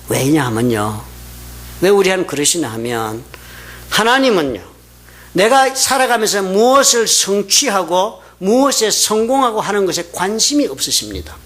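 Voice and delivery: ordinary talk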